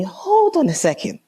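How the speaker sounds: high, quick, indignant halt; indignant interruption. Retold or imitated. Woman to boyfriend on hearing of his ex, indignant. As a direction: stop him short, pitched right up. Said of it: "This is me".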